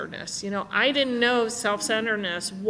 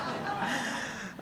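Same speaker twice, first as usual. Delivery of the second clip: soft laugh; 10 dB quieter than usual. Real, not acted. Laughs at own joke.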